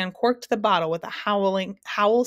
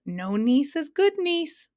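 high, slow, sing-out roar; drawn-out, high-pitched mock roar; gleeful roar; 8 semitones higher, slower than usual. A narrator's reading. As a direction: crow the joke, stretching it upward. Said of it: "roared the Din again".

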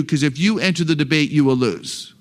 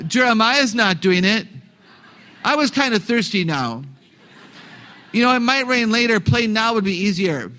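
put-on higher-pitched child making excuses; playing the kid; 5 semitones higher than usual. Kid dodging schoolwork with a parent, high pleading excuses. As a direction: play a stalling kid, voice lifted. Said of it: "I'm the kid".

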